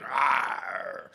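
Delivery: loud roaring "arr"; imitated scream. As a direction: imitate a fighter's scream in one burst. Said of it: "he started screaming".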